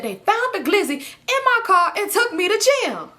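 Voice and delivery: slow, high-pitched incredulous wail; exasperated retelling. Retold or imitated. Boyfriend recounting his arrest, high drawn-out exasperation. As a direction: stretch it out high, imitating his dismay.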